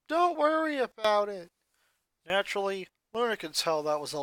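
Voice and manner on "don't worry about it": lightly voiced boy with a forced brightness; a hint of a boy faking cheer; fake cheerful. A narrator's reading. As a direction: brush it off with a fake smile. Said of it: "he gave her a fake smile and said".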